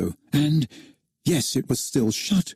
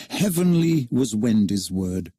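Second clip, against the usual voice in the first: plain reading voice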